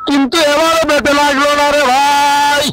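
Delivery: comic cricket commentary. Acted. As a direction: ham up the commentary for laughs. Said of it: "দর্শককে হাসিয়েছেন". (মজার ধারাভাষ্য)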